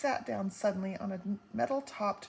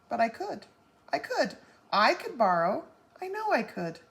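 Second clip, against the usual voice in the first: eager insistence; 3 semitones higher, 6 dB louder than usual. A narrator's reading.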